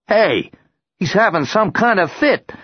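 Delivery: high, loud, alarmed call; alarmed policeman. Acted.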